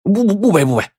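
high stammered string of protests; red-faced, flustered refusal. A narrator's reading. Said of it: "脸红脖子粗地说".

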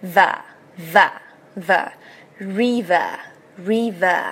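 slow, separate model "ver" and "river"; teeth-on-lip V. Shown as a demonstration. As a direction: repeat "ver" slowly, then build it into "river". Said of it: "上齿要咬着你的下唇".

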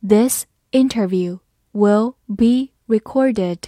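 slow, word by word; slow reading. Shown as a demonstration. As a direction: read it slowly for learners, pausing between words. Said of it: "好，慢慢来读".

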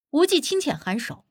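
ordinary delivery, nothing marked

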